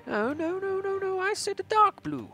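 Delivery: put-on high-pitched squealed protest in Austrian accent; mock indignant protest. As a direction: object in a very high, fussy voice. Mock scolding a character over colour.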